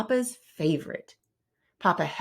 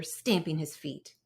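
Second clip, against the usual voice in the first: ordinary delivery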